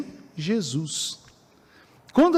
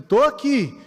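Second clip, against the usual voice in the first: put-on father shouting to his child that he is here; father calling out; 6 semitones higher, slower than usual. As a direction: call out to a lost child so it hears you. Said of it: "o pai já grita".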